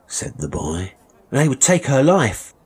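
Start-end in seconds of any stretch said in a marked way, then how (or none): 1.3-2.5 high, loud cry of alarm; frightened pleading boy. Acted. Boy fearing for his mother's life, desperate.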